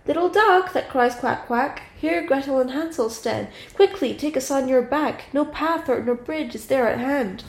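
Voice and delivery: put-on sing-song appeal in a girl's voice; Gretel calling out. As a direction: call to the duck in lilting verse. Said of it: "So she cried".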